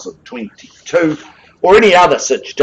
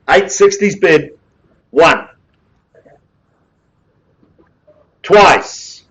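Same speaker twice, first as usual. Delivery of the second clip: brisk auction patter, rising on calls; auctioneer calling bids; 2 semitones higher than usual. Real, not acted. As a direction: drive the room like a live auctioneer.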